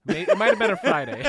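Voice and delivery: laughing through the words. Real, not acted. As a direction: giggly joke. Joking, laughing.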